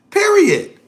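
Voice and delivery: high, loud final word; emphatic period. Real, not acted. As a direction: slam the point shut. Ending his argument with finality.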